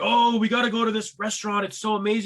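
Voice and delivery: mock-excited foodie friend; gushing friends. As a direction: mimic friends raving about a place. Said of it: "they'll be like".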